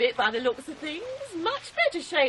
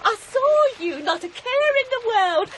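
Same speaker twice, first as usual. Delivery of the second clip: gushing; 8 semitones higher, 5 dB louder than usual. Acted. Gushing gossip greeting Salome.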